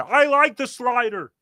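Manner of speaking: hammed-up sitcom dad whine; Ray Romano impression. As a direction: play the pitcher who stubbornly defends his slider. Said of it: "my dumb Ray Romano impression".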